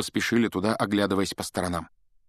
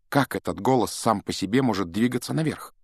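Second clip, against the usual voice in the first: plain reading voice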